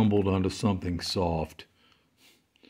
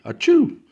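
sharp high sneeze; loud sneeze; 10 dB louder than usual. Acted. Alexis sneezes.

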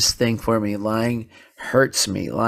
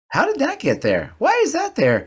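put-on much higher, wide-eyed innocent child voice; innocent kid voice; 4 dB louder than usual. Son feigning surprise to his dad, in a high mock-innocent voice. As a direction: play the boy acting baffled about the hidden tablet. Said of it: "He goes".

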